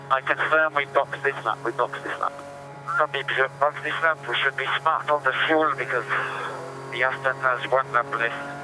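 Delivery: ordinary talk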